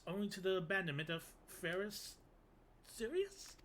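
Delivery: a flustered academic brushing off danger; nervous scholar. Acted. Sienna dismissing spirit talk as folklore, nervously stammering. Reads Sienna covering nerves.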